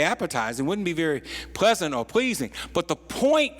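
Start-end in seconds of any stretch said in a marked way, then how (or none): none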